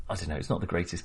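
usual speaking voice

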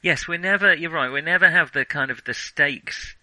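usual speaking voice

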